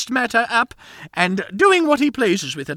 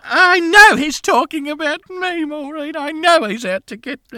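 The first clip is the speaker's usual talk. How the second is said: paranoid outcry; 7 semitones higher, 6 dB louder than usual. Acted. Paranoid outburst.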